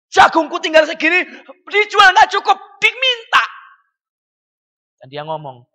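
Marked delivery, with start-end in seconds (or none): 0.1-3.4 high, louder complaining farmer voice; anxious poor farmer. Retold or imitated. Preacher voicing a poor Korean farmer's worry, high and pleading. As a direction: fret over too little corn.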